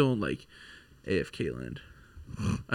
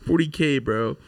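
normal talking voice

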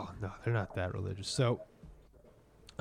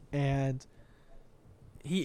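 ordinary talk, nothing marked

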